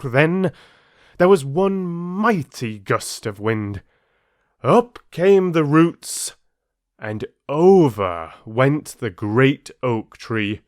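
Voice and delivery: dramatic climax. A narrator's reading. Climax of the storm.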